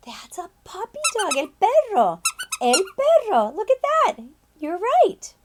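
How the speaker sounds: high, bright praise; excited teacher. Acted. Teacher praising kids after the puppy reveal. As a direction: delight in the reveal.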